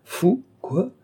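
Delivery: high-pitched, incredulous exclamation; acted outburst of shocked disbelief; astonished cry. A narrator's reading. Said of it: "s'écria l'Aiguillon".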